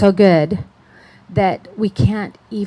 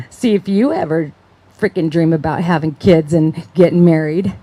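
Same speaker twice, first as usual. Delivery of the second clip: put-on louder, sneering drunken taunt in an odd voice; mocking drunk adults; 2 semitones lower, 5 dB louder than usual. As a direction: taunt the teenage babysitter like a drunk. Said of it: "laughing, saying".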